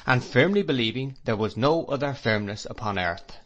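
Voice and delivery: plain reading voice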